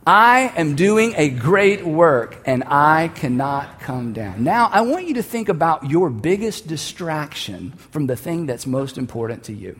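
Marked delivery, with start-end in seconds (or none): 0.0-4.4 slow, deliberate, each word weighted; slow, steady declaration said together; slow group declaration. Shown as a demonstration. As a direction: lead the room in a solemn slow chant. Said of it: "I want us to say this again".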